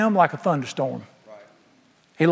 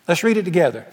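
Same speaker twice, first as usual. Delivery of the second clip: ordinary delivery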